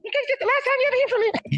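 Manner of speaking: mock Netanyahu. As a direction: rattle it off in a squeaky caricature. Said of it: "I had no idea your voice is gonna sound like that".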